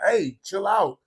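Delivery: high-pitched warning call; sharp hey. Retold or imitated. Telling the kid to calm down, high sharp call. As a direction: pitch it up to snap him out of it. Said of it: "be like".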